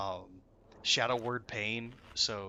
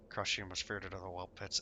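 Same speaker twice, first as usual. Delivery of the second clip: muffled bad mic; 3 semitones lower, 7 dB quieter than usual. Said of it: "has a complete toaster mic".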